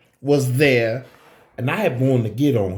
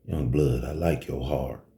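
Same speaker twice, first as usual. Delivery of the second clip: low, quiet dealer voice; 13 semitones lower, 6 dB quieter than usual. Retold or imitated. Chicago dealer sizing up the young bouncer, low and cool. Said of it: "He said just like that".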